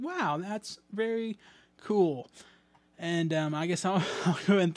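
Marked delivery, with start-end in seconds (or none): 0.0-2.3 slow, drawn-out mock wow; a hint of retold amazement; mock amazed. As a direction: act out the surprised reaction, slowly. Reacting to seeing reviews.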